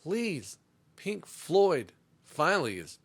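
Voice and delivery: ordinary talk, nothing marked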